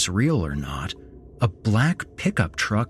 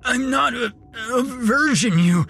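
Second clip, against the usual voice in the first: very high, strained and halting protest; choked out. Acted. Dying victim protesting to his captor, choked high outcry. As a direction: choke the words out in pieces, high-pitched. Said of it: "Philip choked out".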